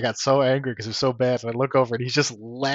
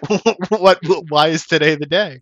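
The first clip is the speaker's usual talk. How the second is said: louder, higher, stammering with laughter; mock incredulous; 6 semitones higher, 6 dB louder than usual. Questioning a friend's excuse, mock incredulous. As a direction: retell baffled questioning, half laughing. Retold exasperated questioning of Chris.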